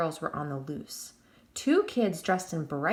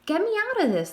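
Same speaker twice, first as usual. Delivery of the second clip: put-on much higher, louder childlike plea; little girl begging; 11 semitones higher, 6 dB louder, faster than usual. A narrator's reading. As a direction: beg from inside the shirt. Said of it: "the little girl begged".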